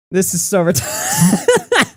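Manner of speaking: slow, high, words squeezed out through laughter; laughing through words. Real, not acted. Reacting to old DMs, laughing through the words. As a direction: say it while still cracking up.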